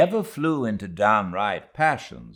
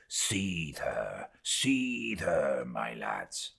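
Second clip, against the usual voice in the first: whispering urge; 6 semitones lower, 8 dB quieter, much slower than usual. Acted. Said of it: "whisperingly urging his crew".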